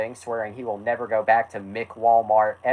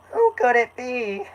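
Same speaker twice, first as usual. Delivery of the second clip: very high, put-on cartoon voice; rough attempt at a squeaky character impression; Carl; 12 semitones higher than usual. Carl answers knock on the door, very high character voice. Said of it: "forgive me if my Carl is, like, not Carl at all".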